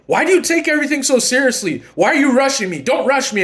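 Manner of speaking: high-pitched mock outburst; blowing up. Partner blows up when rushed, high indignant outburst. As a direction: snap back, defensive and high. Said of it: "they blow up at you".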